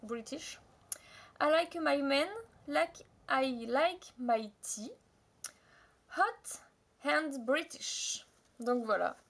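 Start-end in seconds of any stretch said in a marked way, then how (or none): 1.4-8.3 a French speaker sounding out an English slogan; French-accented English. Real, not acted. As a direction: read the English phrase out slowly in schoolgirl English. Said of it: "excusez mon anglais".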